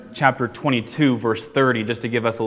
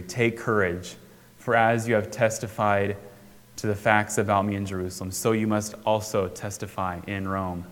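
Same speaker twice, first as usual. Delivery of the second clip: softer, lower, gentle reassurance; tender quiet; 3 semitones lower, 5 dB quieter than usual. Acted. Jesus comforting a worn-down Paul, soft and tender. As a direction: say it like a quiet promise to someone at a low point. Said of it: "at this low moment".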